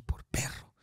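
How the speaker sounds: tiny whispered verdict; whispered thought. Retold or imitated. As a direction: breathe it out as a secret. Inner thought while robbing man.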